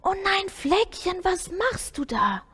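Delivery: slow, high cry of dismay. A narrator's reading. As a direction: call out in shock, drawn out. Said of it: "schrie er laut auf".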